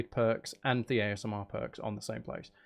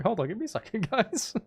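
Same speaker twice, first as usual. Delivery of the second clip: ordinary delivery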